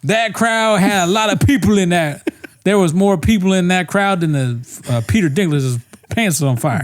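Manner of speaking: high-pitched parody of hype talk; mock host riff. Mimicking the host's overblown similes.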